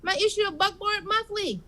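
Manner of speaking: high, flustered coworker voice; high secretary voice; Sue. Acted. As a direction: search around high and fussy as Sue. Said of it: "No, no, Bob".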